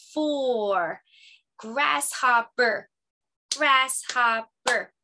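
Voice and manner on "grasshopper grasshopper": "Grasshopper" slowly in three clapped beats; clapped syllables. Shown as a demonstration. Teacher demonstrating syllable clapping, slow and deliberate. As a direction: clap out "Grasshopper", slow and even. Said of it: "So I'll demonstrate".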